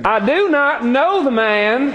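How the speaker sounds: put-on cursing Peter in a high hillbilly Galilean accent. Peter cursing at the crowd: high laughing hillbilly Galilean accent. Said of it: "they kind of talk like this".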